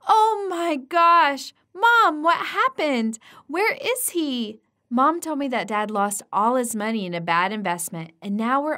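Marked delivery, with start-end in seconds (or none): 0.1-4.6 high, loud alarmed cry; shocked panic. Acted. Daughter hearing her dad had a heart attack, high alarmed cry.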